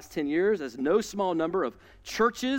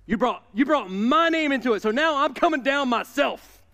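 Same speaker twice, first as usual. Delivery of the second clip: God fired up; 5 semitones higher, 5 dB louder than usual. Retold or imitated. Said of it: "he's like".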